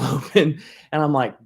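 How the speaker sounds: normal talking voice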